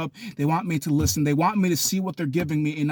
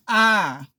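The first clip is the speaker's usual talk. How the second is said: short, raised wail; crying out; 4 semitones higher, 4 dB louder than usual. Acted. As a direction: wail briefly instead of answering. Acts out the wordless screamer.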